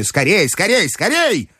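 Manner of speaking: high, rising urgency; high-pitched old-lady urging; impatient grandmother. A narrator's reading. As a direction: hurry him along with growing insistence. Grandmother hurrying the narrator.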